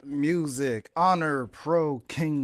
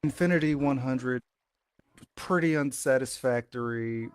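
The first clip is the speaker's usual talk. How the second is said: wrestling promo; 6 semitones lower, slower than usual. Acted. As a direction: gloat over the last match.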